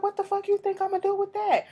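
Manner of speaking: high, loud mock outrage; incredulous woman. Retelling the girl's reply to the ring, mocking her disbelief in a high voice. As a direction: play her disbelief, voice pushed way up. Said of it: "She was like".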